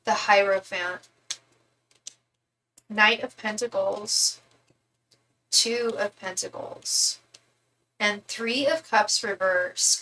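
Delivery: drawn-out, deliberate card names; slow card reading. Acted. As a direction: read each card slowly. Slowly naming tarot cards.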